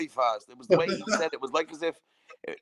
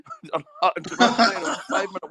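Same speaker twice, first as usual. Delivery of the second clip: laughing; 5 semitones higher, 4 dB louder than usual. Real, not acted. Laughing at own story.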